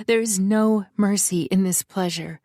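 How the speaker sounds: usual speaking voice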